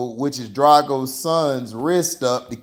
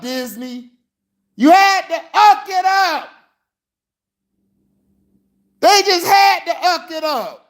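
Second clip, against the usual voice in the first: pitched-up, exasperated venting; angry rant; 13 semitones higher than usual. Real, not acted. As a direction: rant at Disney like a betrayed fan. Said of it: "I had to get mad".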